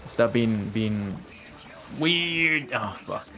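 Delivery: ordinary talk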